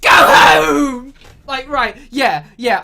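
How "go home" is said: hammed-up, high shouted command; loud melodramatic dismissal, imitating; mock Frodo shout. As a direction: bellow it as an overwrought hero. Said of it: "But he's like".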